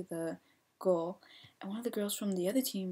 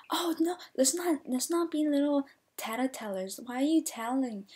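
high, scolding imitation; put-on higher voice, chiding; mocking captain; 5 semitones higher than usual. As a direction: mimic a captain sneering at tattletales.

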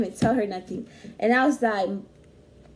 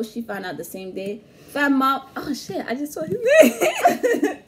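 ordinary delivery

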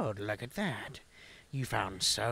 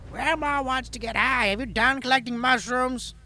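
put-on old granny voice; 13 semitones higher, 7 dB louder than usual. High granny voice.

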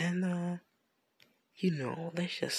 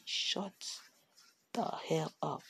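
usual speaking voice

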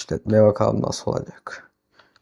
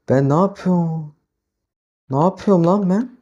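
higher-pitched, rising self-questioning; baffled at your own move, voice climbing; 6 semitones higher than usual. Real, not acted. Oyunda kendi hatasına şaşırıyor. (şaşkın, tizleşen sesle)

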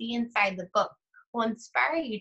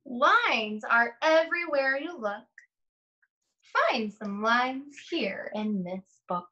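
put-on slow, sing-song read-aloud to young children; lingering on each rhyme, long pauses between pages; story read aloud; 3 semitones higher, much slower than usual. Reads picture book aloud slowly.

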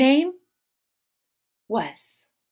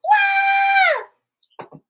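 high, loud fake bawling; the storyteller hams up Andrea's crying for the kids; mock toddler wail; 7 dB louder than usual. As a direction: howl like a frustrated little girl. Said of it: "The same thing".

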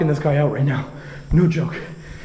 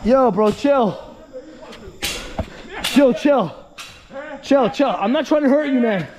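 urgent pleading; 8 semitones higher, slower than usual. Real, not acted. Telling an armed man he means no harm, urgent shouting. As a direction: call out to defuse him.

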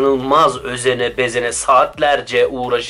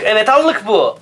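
excited; 8 semitones higher, 5 dB louder than usual. Acted. Presenting blush, excited.

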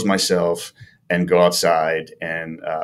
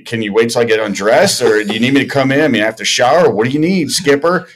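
higher, cheeky comeback rising toward the end; smart-alecky; 3 semitones higher, 4 dB louder than usual. Retold or imitated. Player answering his manager's summons, smart-alecky comeback. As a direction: talk back like a little wise guy. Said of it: "this little smart alecky comment like".

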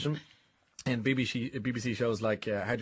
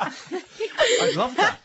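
laughing; 5 dB louder, much slower than usual. Real, not acted. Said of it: "laughing reaction".

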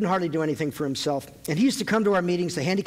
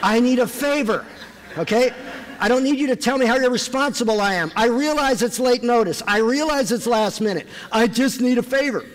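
louder, higher frustrated rant at God; exasperated plea; 5 semitones higher, 3 dB louder than usual. Retold or imitated. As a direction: vent your frustration upward. Exasperated prayer to the Lord.

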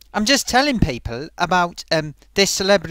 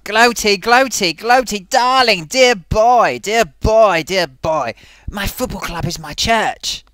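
put-on loud theatrical dear boy voice; pompous thespian, ending grand; luvvie actor voice; 5 dB louder, slower than usual. Thespian puppet declares his club is his church, loud luvvie voice.

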